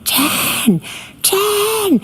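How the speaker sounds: high-pitched mock scream at normal volume; retold scream. Witness imitates defendant screaming for Jen, high mock scream. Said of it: "and she's screaming".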